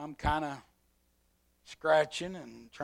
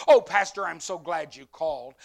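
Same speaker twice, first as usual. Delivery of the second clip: relieved commander; 3 semitones higher, 13 dB louder than usual. Retold or imitated. Commander glad the pastor called, loud and relieved. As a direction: greet him with loud relief.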